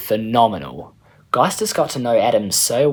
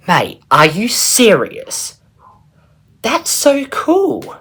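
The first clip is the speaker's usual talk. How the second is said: slow, loud squeal of amazement, much higher on cool; amazed girl; 12 semitones higher, 7 dB louder, much slower than usual. Acted. Geistes amazed Eric is her pen pal's brother, squealing. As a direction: gush with delight at the news.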